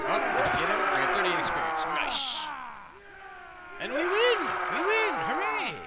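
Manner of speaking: loud, high-pitched cheering; excited shout of triumph; victory cheer. Real, not acted. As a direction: whoop as the battle is won. Cheers winning the battle.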